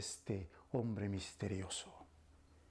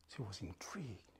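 whispering; 3 semitones lower, 9 dB quieter than usual. A narrator's reading. Hushed story narration.

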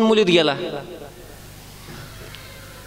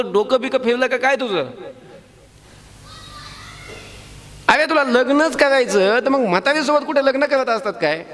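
higher, incredulous elder-brother scold; Balram scolding; 8 semitones higher than usual. Acted. Balram mocking Krishna's choice of an old bride, higher and incredulous. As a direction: tease the little brother as if he's gone mad. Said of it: "म्हणजे".

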